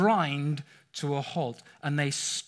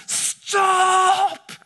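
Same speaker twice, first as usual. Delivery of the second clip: huge high panicked yell; desperate scream of stop; shout at the top of lungs; 13 semitones higher, 8 dB louder than usual. Retold or imitated. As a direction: scream it to stop the kids before the bus. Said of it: "shouted out at the top of my lungs".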